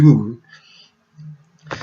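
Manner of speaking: slow stretched-out oui, oui, oui; drawn-out yes. Retold or imitated. To the hotel receptionist, slow stretched oui, oui, oui. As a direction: stretch each oui out long and eager.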